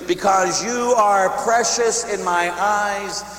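very slow, stretched scripture line; slow, solemn. Acted. Preacher slowly reading God's promise to the congregation. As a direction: draw it out as a blessing.

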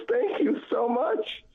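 high, slow, heartfelt thank you; slow, high mock gratitude; grateful thanks. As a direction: thank him with real gratitude. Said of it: "She goes".

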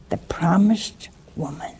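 hushed title reveal; whispered reverent title. Real, not acted. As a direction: breathe the title like a secret. Announcing his book title hushed.

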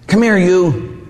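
high, affectionate "come here"; a loving father beckoning; fond call. Acted. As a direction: call a child into your arms. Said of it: "your Father calls you by name".

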